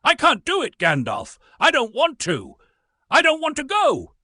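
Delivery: high, loud desperate pleading; a frightened hobbit crying out high and loud against the wizard. A narrator's reading. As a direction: beg as if about to be dragged off.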